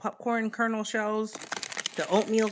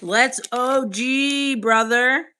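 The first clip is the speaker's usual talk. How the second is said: slow, louder hyped drawl; drawn-out chat read; 4 semitones higher, 6 dB louder, slower than usual. Pretend. Reading a hype chat message aloud, slow and loud. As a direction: stretch it out like a hype bro.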